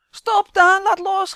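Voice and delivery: shrill, very loud high scream. A narrator's reading. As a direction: scream at him to let go. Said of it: "gilt Jaap".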